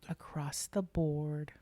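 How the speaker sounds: slow hushed whisper. Acted. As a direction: whisper the title back slowly. Echoing the whispered title.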